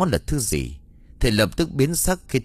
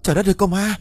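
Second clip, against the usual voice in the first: high shriek of sudden terror; terrified scream; 10 semitones higher, much faster than usual. Acted. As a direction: scream as if seeing a ghost. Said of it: "mà thét lên". (thét lên kinh hãi)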